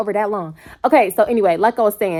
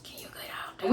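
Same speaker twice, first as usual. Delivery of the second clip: breathy whispered aside; hushed get out; 19 dB quieter than usual. Real, not acted. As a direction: whisper it as a joke.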